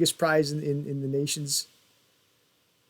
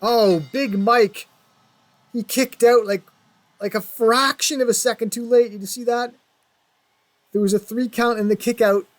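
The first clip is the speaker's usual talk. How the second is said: loud, high excited call; excited commentator; 9 semitones higher, 7 dB louder than usual. Real, not acted. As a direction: shout the near fall in disbelief. Near fall, excited call.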